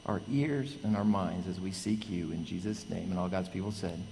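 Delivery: soft, reverent prayer voice; quiet prayer. Acted. Pastor praying softly over friend and church. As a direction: pray gently over the congregation.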